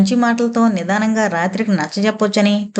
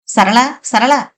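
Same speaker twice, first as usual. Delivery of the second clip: mildly raised, insistent call; calling out loudly; 3 semitones higher, much slower than usual. A narrator's reading. Irritated husband calls out for his wife, a restrained yell. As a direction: call the name twice, a little sharply. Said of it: "ఏంటండి ఆ గావు కేకలు".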